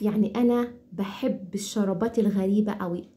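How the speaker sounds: normal talking voice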